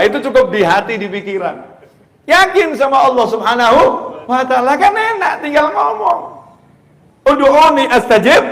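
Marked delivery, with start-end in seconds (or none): 2.3-8.4 high, loud fiery sermon; impassioned preacher; preaching at full force. Real, not acted. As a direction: drive the point home to the crowd.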